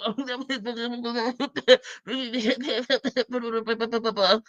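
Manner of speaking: long mock stammering babble; inaudible mumbling. Woman showing how he dodges questions, imitated garbled mumbling. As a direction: imitate him jabbering without real words. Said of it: "You know how he do".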